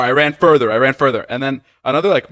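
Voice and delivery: ordinary delivery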